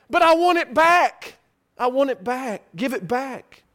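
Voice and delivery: loud high plea, fading; desperate pleading. Retold or imitated. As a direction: beg loudly, then trail off. Sinner pleading at Father's feet.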